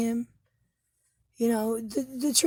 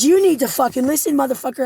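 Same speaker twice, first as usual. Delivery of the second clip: louder, higher retold yell at him; reliving the moment she finally snapped; retold angry yell; 5 semitones higher, 6 dB louder than usual. Woman retelling how she cursed at her partner, angry yell. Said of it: "I got to the point where I said".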